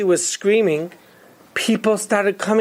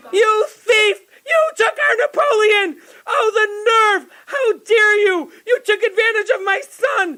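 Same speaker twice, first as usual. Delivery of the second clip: high-pitched outraged tirade; screaming woman, far higher than usual; 11 semitones higher than usual. Acted. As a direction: shriek accusations like a furious mother. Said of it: "she started screaming like only a woman knows how".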